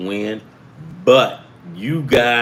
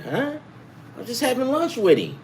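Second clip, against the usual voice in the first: very high baffled huh; sharp high puzzled huh; confused huh; 11 semitones higher, 4 dB quieter than usual. Retold or imitated. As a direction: sound totally caught off guard. Retelling his confused reaction.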